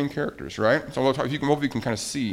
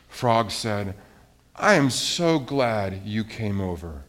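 low, soft bedtime-story ending; story narration; 6 semitones lower, 3 dB quieter, slower than usual. A narrator's reading. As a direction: read it gently, with long pauses, winding down. Reads story's calm ending.

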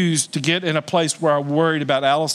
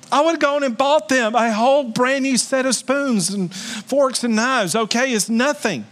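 rising preacher fervor; 8 semitones higher than usual. Real, not acted. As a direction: pitch climbing high as the point builds. Preacher heats up over parents' quarrel.

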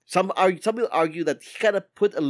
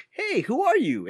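a higher squeaky Toad; 11 semitones higher than usual. Retold or imitated. Host voices Toad asking Mario who he is, higher. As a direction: ask it in a small, higher mushroom voice. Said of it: "Toad goes like".

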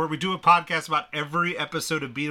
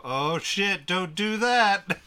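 alarmed shout; 7 semitones higher, 5 dB louder, much slower than usual. Retold or imitated. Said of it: "you would go".

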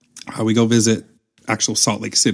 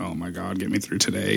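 much softer, low and drained; hungover groan; 3 semitones lower, 7 dB quieter than usual. Retold or imitated. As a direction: groan it like a pounding headache. Hungover, head pounding.

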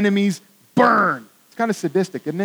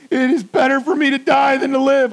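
higher, louder moaning wish to die; despairing Jonah; 7 semitones higher, 4 dB louder than usual. Acted. Jonah tells God he would rather die, moaning. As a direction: whine it like a sulking prophet begging to die. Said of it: "I think that's how he sounded".

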